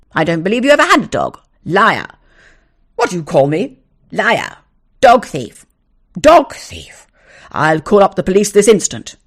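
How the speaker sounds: loud, fast shouted insults. Acted. Neighbour calling the owner a liar, shouting. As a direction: hurl liar at him.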